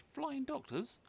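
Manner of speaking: high, delighted exclamation; light up at the thought; thrilled idea. A narrator's reading. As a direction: Zog eagerly proposing a new job. Zog excited by idea.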